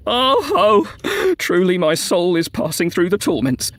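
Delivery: high drawn-out lament, slowing; common man's wail. A narrator's reading. Common man among the dead suffering torments, wailing. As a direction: wail "oh" high, then intone mournfully. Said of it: "resounded the voice of the man of the common people".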